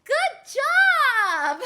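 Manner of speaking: loud, high, laughing praise; cheery praise shout. Pretend. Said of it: "teacher praising class".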